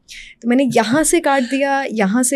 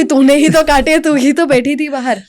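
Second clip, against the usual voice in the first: put-on louder, higher childish blame; mock accusation; 5 semitones higher, 5 dB louder than usual. Toddler accusing the narrator, mock loud and higher. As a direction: point the finger with a raised voice.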